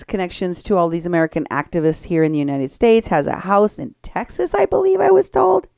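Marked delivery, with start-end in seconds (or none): 4.5-5.7 high unsure aside. Real, not acted. Host hedging about a tip from listeners, high and doubtful. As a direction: pitch way up, doubtful.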